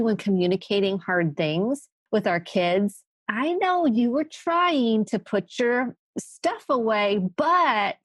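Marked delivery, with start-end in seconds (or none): 3.3-7.2 higher, drawn-out parental reproach; slow, mock-patient parent leading up to a criticism; parent to child. Voices parent talking to kids. 7.4-8.0 "but" pitched high and drawn out; stress on but. Acted. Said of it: "they emphasize the word but".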